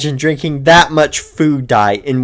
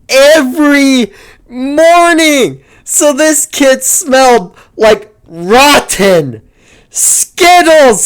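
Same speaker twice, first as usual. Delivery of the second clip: disgusted; 10 semitones higher, 6 dB louder, much slower than usual. Acted. Grossed out by Skittles-smelling kid.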